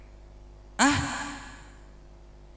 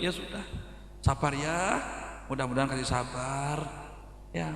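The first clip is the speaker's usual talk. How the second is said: low, soft sweet-talk; mock consoling; 9 semitones lower, 13 dB quieter than usual. Friend sweet-talks the crying wife, low and hushed. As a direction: coax her quietly like a smooth charmer.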